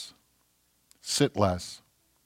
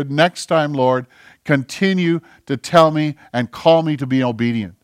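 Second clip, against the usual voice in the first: louder declaring testimony; full-force preaching; 4 semitones higher, 6 dB louder than usual. Acted. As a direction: proclaim it boldly.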